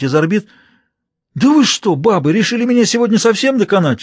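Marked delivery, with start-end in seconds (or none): 1.3-4.0 high, loud plaintive moan; plaintive wail. A narrator's reading. Prince wailing at both women, high plaintive moan. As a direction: whine at the women like a broken man. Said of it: "жалобно простонал он".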